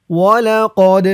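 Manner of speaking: ولقد with the qaf given a bounce; tajweed demo. Shown as a demonstration. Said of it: "কফ দিয়ে ডাল ধরবে".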